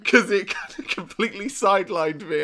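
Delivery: laughing through the words; fighting back giggles; trying not to laugh. Real, not acted. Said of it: "I'm losing it over Bat Child".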